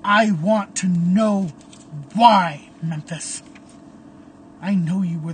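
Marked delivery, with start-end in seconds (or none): 0.0-3.4 loud, raging yell at a former friend; furious shout. Acted. Caleb yelling at Memphis, furious and loud. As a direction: spit out every word in fury.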